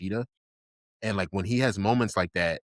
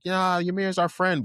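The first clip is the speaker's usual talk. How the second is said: high, drawn-out explaining voice; pleading Krista; 10 semitones higher, 3 dB louder, slower than usual. Retold or imitated. Imitating Krista explaining to Mikasa, high pleading voice. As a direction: plead it high and slow, mock-earnest. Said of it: "Krista's trying to explain to her".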